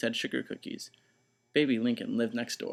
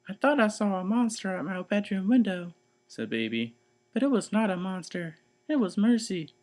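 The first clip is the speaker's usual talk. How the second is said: 1 little sister; 8 semitones higher, 3 dB louder than usual. Acted. Baby voice.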